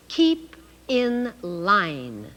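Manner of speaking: very slow, clear sign reading. Shown as a demonstration. Teacher reading a sign to children very slowly. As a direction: stretch each word out for learners. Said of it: "here's a long one".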